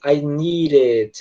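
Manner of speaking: very slow "I need it", D turned to an R tap; drawn-out American "I need it" with flapped D. Shown as a demonstration. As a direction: stretch out "need it" as "neee-rit". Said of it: "a pronúncia acaba saindo assim".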